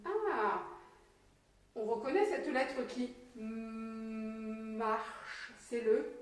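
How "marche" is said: MARCHE with a long hummed M at the start; stretched first sound. Shown as a demonstration. Teacher links the letter to a word, stretched first sound. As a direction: hold the "mmm" of "marche" before releasing it. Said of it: "on va essayer d'écouter à l'intérieur de cette syllabe".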